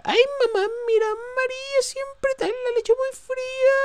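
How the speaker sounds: very high falsetto, slow; comic quote. Retold or imitated. Gamer quotes a joke line in a high falsetto. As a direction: squeak it out in falsetto. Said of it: "Como diría".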